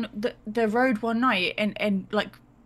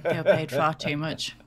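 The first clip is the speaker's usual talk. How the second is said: usual speaking voice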